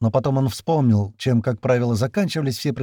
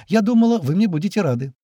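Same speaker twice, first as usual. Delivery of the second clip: put-on higher-pitched, innocent girlish line; 8 semitones higher, 3 dB louder than usual. A narrator's reading. Girl teasing Sergei on his lap, innocent. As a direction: play innocent in a raised voice. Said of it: "невинно произнесла девушка".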